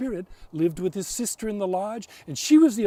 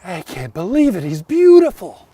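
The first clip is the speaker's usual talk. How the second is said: awed wonder; 14 dB louder than usual. A narrator's reading. Girl seeing the spirit, loud awed wonder. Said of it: "she said".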